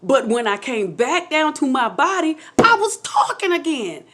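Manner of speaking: high, rising excitement; thrilled, emphatic revelation; getting excited. Real, not acted. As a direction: build up to an amazed exclamation. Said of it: "I'm getting excited. I might get loud".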